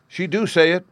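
put-on high, insistent child voice; high, stubborn small boy; persistent little boy. A narrator's reading. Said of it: "Bruno persisted".

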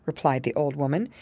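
plain reading voice

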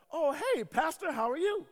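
high, loud, slow neighborly greeting; old lady impression. Old neighbor greeting the pastor over the fence, old lady impression. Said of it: "it's my old lady voice".

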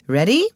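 questioning director's prompt. A narrator's reading. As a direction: ask it as a quick check, voice lifting. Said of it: "came in questioning tones".

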